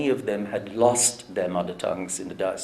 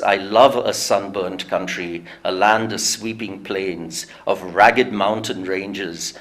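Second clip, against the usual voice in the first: slow, louder recital with measured weight; poem recitation; 2 semitones lower, 4 dB louder than usual. Shown as a demonstration. Lecturer recites a patriotic poem to the audience, slow and declaiming. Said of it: "it goes like this".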